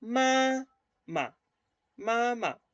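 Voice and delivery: "ma" with the sound cut short; clipped "ma, ma" in the neutral tone. Shown as a demonstration. Teacher showing the class the neutral tone. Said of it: "El sonido se corta en el tono neutro".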